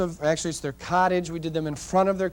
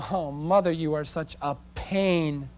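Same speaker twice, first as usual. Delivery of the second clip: slow, dragged-out mock complaint; exasperated kids; 4 dB quieter than usual. Tired kids complaining to their mother, exasperated. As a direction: draw it out like a fed-up kid.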